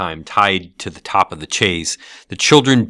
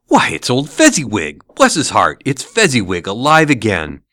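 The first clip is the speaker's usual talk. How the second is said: loud, raised joyful cry; great excitement; 3 semitones higher, 5 dB louder than usual. Acted. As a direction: burst out in delighted recognition. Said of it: "Scrooge cried in great excitement".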